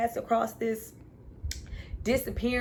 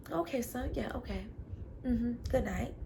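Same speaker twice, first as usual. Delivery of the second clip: much softer brush-off; dismissive father; 2 semitones higher, 8 dB quieter than usual. Retold or imitated. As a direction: wave him off quietly. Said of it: "father brushes son off".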